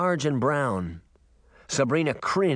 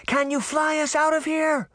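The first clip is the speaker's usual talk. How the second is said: Sabrina's voice. A narrator's reading. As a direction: call out to him over the wind. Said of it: "Sabrina said".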